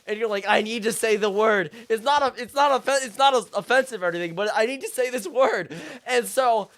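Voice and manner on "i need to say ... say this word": loud, raised, insistent pleading; frantic pleading. Acted. Customer explaining to alarmed shoppers, in frantic pleading. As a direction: plead loudly over the commotion.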